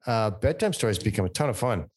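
normal talking voice

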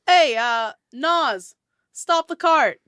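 dwarf calling out. Acted. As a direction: call up to the cart with a grin. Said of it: "he's like".

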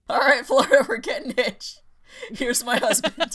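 loud, high mock admiral breaking into laughter; amused mock announcement. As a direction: announce it grandly while cracking up. Imagined Admiral, voiced while laughing.

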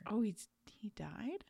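hushed whispered question; whispering on the phone. Real, not acted. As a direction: whisper it back, unvoiced. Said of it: "don't be whispering into the phone".

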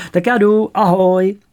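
high, loud hurried goodbye; eager goodbye. A narrator's reading. Boy leaving lunch in a rush, excited and loud. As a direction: blurt it out and bolt.

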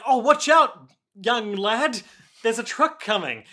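playfully yelling a warning; mock warning shout. The man warns the boy about the truck, mock-urgent shout. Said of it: "He's like".

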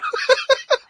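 high burst of laughter. Real, not acted. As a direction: laugh out loud. Laugh after sneeze.

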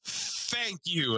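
slow, high overjoyed thank you; hammed-up heartfelt gratitude; drawn-out grateful thanks. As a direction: stretch the thanks out in relief. Thanking for found phone.

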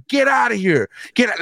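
lightly voiced indignant old women; old ladies outraged.

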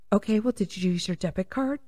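soft, polite agent imitation; bank agent voice. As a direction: mimic the bored bank clerk quietly.

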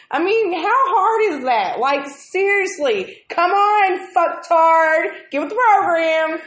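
high, loud fed-up venting; exasperated rant. Real, not acted. Retelling the onion fiasco to a friend, loud and exasperated. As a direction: let the irritation boil over. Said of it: "I was so fucking irritated".

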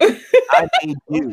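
high, slow, hammed-up Federer boast; mock Federer. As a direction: lord it over him in a silly high drawn-out voice. Federer voiced as smug mentor.

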